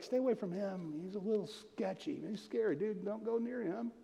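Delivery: acted hushed caution about a sketchy man; hushed warning. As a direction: warn a friend off someone under your breath. Acting people warning about sketchy old man.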